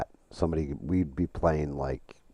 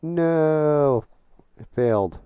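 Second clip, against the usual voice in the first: loud, higher despairing no; frustrated shout; 11 semitones higher, 8 dB louder, much slower than usual. Real, not acted. Streamer shouting as the game crashes, frustrated. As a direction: cry out as it fails.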